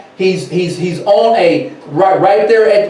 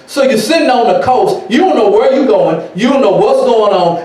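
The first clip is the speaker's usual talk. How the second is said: ordinary delivery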